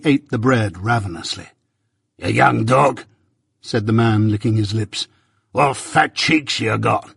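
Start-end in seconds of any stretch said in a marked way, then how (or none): 2.2-3.0 gruff convict. Acted. Convict to boy. 5.5-7.1 gruff convict. Acted. Convict eyes boy hungrily.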